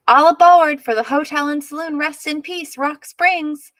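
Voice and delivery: loud, high calling out; announcing the sleigh at the top of his voice; hollered. A narrator's reading. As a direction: holler it to the passengers. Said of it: "the driver hollered".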